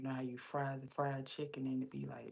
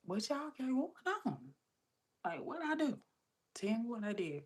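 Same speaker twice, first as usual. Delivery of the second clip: high-pitched retold bewilderment; acted-out suspicion, voice jumping right up; self-conscious questioning; 9 semitones higher than usual. Asking pantry workers what is going on, self-conscious. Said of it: "self-conscious".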